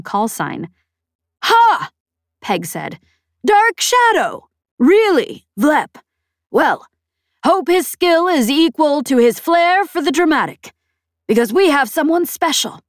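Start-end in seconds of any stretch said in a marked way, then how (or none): 1.4-1.9 loud high scoffing "Ha"; scoffing laugh. A narrator's reading. Peg scoffs. 3.4-12.8 high, loud, mocking disbelief. A narrator's reading. Peg mocks the name.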